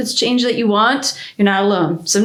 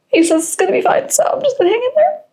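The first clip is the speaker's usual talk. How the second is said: panicky squeak. Real, not acted. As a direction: voice climbing way higher, panicked. Panicking over paint colour.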